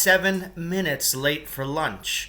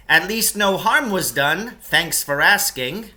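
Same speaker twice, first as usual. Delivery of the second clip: higher, louder, sarcastic thanks, slowing at the end; sarcastic grumble; 6 semitones higher, 7 dB louder than usual. Acted. Lance sarcastically complaining to his rescuers, loud and higher. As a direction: make the dig land.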